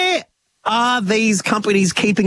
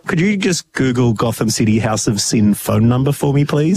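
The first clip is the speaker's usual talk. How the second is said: mock request; 9 semitones lower than usual.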